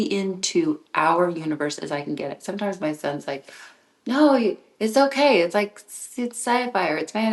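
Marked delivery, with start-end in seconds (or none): 4.1-7.3 high, loud teasing son voice; mock son brushing it off, pitched up; imitating son. Son dismissing her book as sci-fi, high and loud. Son's voice quoted.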